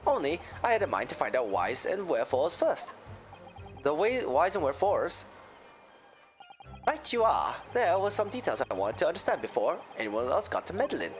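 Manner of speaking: a lilting Irish brogue; Irish accent. Acted. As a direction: tell it like an Irish witness. McGilded in accent.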